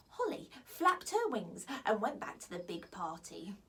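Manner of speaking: usual speaking voice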